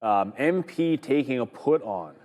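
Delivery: usual speaking voice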